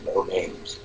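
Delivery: a mock-secretive hush; hushed no names. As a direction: warn him off quietly. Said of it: "I heard Gio's voice".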